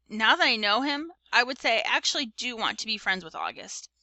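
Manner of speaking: ordinary delivery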